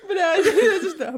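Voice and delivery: high, loud, laughing disbelief; shocked laugh. Real, not acted. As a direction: blurt it out laughing.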